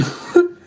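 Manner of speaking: sudden high-pitched laugh; burst of laughter. Real, not acted. Reader amused mid-warning, a high burst of laughter. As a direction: let a quick laugh burst out. Laughs after exclaiming oh my God.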